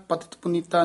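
"ਪਤਿਤ ਪੁਨੀਤਾ" quickly, with no break; the two words joined as one quick phrase; run together. Shown as a demonstration. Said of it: "ਨਹੀਂ ਕਹਿ ਦੇਣਾ".

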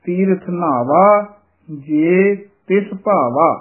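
slow, with ਜੇ set apart from ਤਿਸ and ਨਾਵਾ said heavy; ਜੇ and ਤਿਸ kept apart, slowly; slow separated recitation. Shown as a demonstration. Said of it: "ਜੇ ਨੂੰ ਨਖੇੜ ਕੇ ਪੜ੍ਹਨਾ ਹੈ". (ਹੌਲੀ, ਜੇ ਨੂੰ ਨਖੇੜ ਕੇ)

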